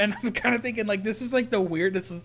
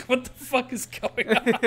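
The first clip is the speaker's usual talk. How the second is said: mock baffled; 6 semitones higher than usual. Baffled at pizza guy's arrival.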